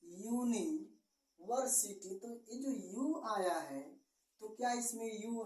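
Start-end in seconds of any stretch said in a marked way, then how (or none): 0.0-2.1 यूनिवर्सिटी said very slowly, starting yu; careful demo pronunciation. Shown as a demonstration. Teacher shows students how the word sounds. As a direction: stretch out यूनिवर्सिटी so the yu sound is heard. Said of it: "इसका हम उच्चारण करेंगे तो ये होगा".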